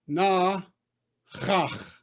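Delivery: "Na", pause, then "chach"; teacher sounding out "Na ... chach"; two throaty chs. Shown as a demonstration. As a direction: scrape both chs in "chach". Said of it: "you got two chs in a row".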